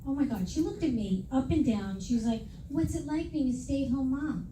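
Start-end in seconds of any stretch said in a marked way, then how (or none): none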